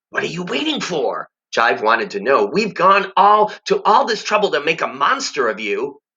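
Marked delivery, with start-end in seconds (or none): 0.1-1.3 prodding tempter. Acted. Jive prodding Harvey. 2.5-6.0 higher, pushy creature voice; a higher sly creature scolding; impatient tempter. Acted. Jive scolding Harvey.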